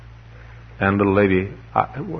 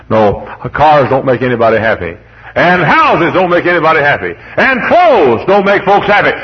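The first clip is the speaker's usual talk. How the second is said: builds from firm to a high, loud preacher's cry; rising pulpit fervour, each line bigger; preaching crescendo; 5 semitones higher, 4 dB louder than usual. Acted. Preaching, building to full force.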